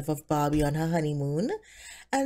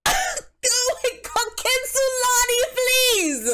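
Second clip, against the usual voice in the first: much higher, loud mock-pleading squeal; exasperated squeal; 5 dB louder, slower than usual. Barbie squeals at Ken Sulani, high and exasperated. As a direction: shriek his name in dramatic disbelief.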